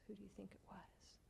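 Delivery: put-on fast, hushed question to the children; teasing whisper. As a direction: whisper it quickly like a secret.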